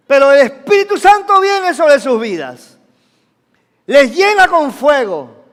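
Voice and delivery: loud, emphatic proclamation; forceful preaching. Real, not acted. Preacher tells of the Spirit coming on their lives, forceful.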